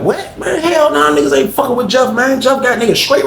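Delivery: high-pitched, disbelieving homeboy; retold shocked objection, pitch way up; incredulous refusal. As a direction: imitate a friend balking at the idea. Homeboy's acted refusal of robbery.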